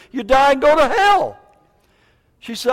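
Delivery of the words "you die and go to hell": higher, louder blast on hell; loud warning. Real, not acted. Preacher warns the church folks, loud and high. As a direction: hit them with the warning.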